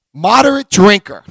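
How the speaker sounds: loud, punchy call of the term. Real, not acted. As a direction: punch it out to the room. Calls out term to crowd.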